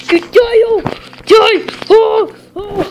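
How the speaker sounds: loud, high battle shouts; frantic high gamer yells; combat yelling. Real, not acted. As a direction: shout it as you attack. Attacking opponent in game.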